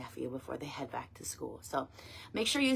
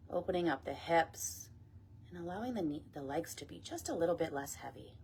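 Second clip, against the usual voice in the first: guided meditation; 6 dB quieter than usual. Acted. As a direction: ease them gently down, phrase by phrase. Leads meditation and breath work.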